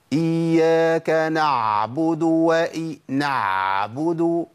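العين في "إياك نعبد" مبالغ فيها ومطولة; ضغط زائد على العين في "نعبد"; exaggerated ain. Shown as a demonstration. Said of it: "بسمع ناس بتقول إيه". (نطق العين بمبالغة مستبشعة)

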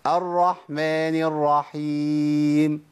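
drawn-out recitation with a slack ح in الرحمن; faulty weak ح. Shown as a demonstration. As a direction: chant الرحمن الرحيم slowly, barely releasing the ح. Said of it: "أنا ما طلعتش الحاء".

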